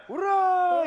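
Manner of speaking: a loud rousing shout of hurrah; battle cry. Retold or imitated. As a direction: yell it like charging out of a trench. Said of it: "и так".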